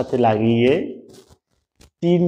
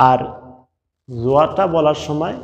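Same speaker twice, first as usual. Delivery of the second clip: ordinary talk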